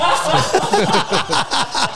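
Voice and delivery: very high, bright laughter that rises; high burst of laughter. Real, not acted. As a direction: crack up at the bald joke. Laughs at bald miner joke. (听到秃头笑话时高声大笑)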